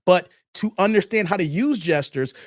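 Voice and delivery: ordinary delivery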